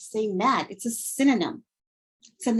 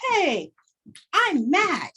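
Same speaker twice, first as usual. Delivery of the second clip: mock mad; 7 semitones higher, slower than usual. Shown as a demonstration. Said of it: "they're saying with their body".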